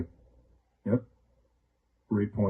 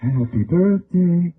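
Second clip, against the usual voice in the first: higher, breathy slow sultry sing; Marilyn Monroe style; 6 semitones higher, much slower than usual. Pretend. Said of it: "not gonna do it like Marilyn Monroe".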